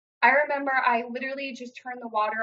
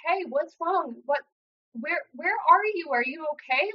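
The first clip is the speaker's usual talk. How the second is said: retold concern, pitched higher; concerned friend; 6 semitones higher than usual. Friend answering her distressed phone call, retold with concern. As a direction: imitate a friend's urgent, higher-pitched questions.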